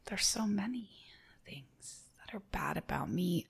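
soft, breathy near-whisper; hushed confession. Acted. Guest asked their worst trait, hushed whispered confession. Mock confession of own faults.

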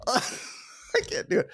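ordinary talk

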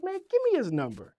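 high-pitched retold urgency; mock-excited, high voice without extra volume; excited demand. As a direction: imitate his eager demand, pitch way up. Retold urgent demand after the surprise.

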